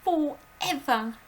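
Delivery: put-on high, loud, slow anguished child; high distressed little girl; breathless girl's cry. A narrator's reading. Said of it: "Matilda cried".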